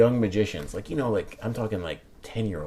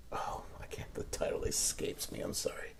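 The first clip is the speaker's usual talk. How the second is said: whispered apology to himself; whispered under the breath; 13 dB quieter than usual. Real, not acted. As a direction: drop to a whisper, embarrassed. Mumbled aside, forgot book title.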